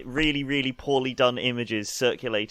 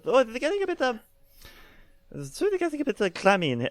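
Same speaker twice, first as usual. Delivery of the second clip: much higher, fussy voice; high character voice; 9 semitones higher, faster than usual. Acted. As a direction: squeak it out as a fussy figure. NPC voice, high.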